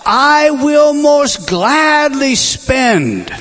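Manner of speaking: preacher leaning hard on "gladly spend"; emphatic, drawn out. Acted. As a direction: stretch each word of "I will most gladly spend" with full force. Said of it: "an emphatic word that's said here".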